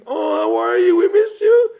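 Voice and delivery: excited shouting of long-lost friends; joyful reunion shout. Acted. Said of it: "They were rejoicing".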